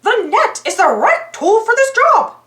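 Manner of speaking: high cartoon-mouse voice, cheerful and sure; little hero picking the right tool; Mickey Mouse voice. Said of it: "said Mickey".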